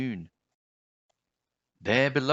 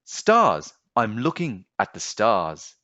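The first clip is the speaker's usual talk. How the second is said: put-on boy calling up, louder; excited boy; 7 dB louder than usual. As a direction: burst out with delight.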